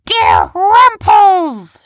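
high, long-drawn call to a crowd; rallying shout. A narrator's reading. Leader addressing her army, slow, high shout. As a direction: shout it slowly, stretching each word. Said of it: "she shouted".

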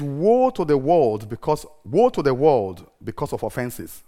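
slow, loud preaching of the verse; booming pulpit reading; forceful scripture reading. Acted. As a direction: proclaim it slowly to the congregation.

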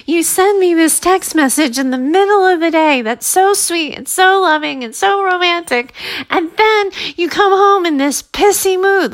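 high, mock-distraught tirade; worked-up complaint. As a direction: act out spiraling into an argument. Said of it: "I'm in it, my friends".

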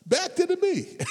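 high, loud, laughing protest; half laughing while accusing; mock fight over a dented car; laughing indignation. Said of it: "you're like".

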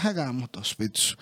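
low, hushed swearing; muttered curse. Retold or imitated. Son trailing behind mother, low muttered curse. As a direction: swear under your breath.

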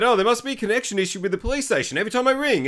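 high, fast put-on Hubie. Hubie telling the cop about calls, high and fast. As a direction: rattle it off like fretful Hubie.

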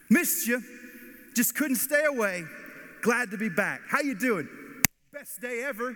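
fake cheerful. Churchgoer pretending all is well, hammed-up fake cheer. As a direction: gush like everything is fine when it isn't. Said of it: "like we do so well".

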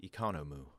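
Economou said carefully, stress on KAH; name pronunciation. Shown as a demonstration. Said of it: "like cheap milk".

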